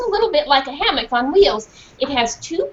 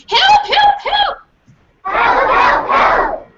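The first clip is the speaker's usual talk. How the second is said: frantic cry; 5 dB louder, much slower than usual. Acted. Dog barking for help.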